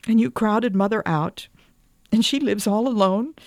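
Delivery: high-pitched, mildly tearful outpouring; tearful pleading. A narrator's reading. Wife telling husband of her hungry heart, tearfully pleading. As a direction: plead with rising pitch, as if near tears. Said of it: "Will you stop crying".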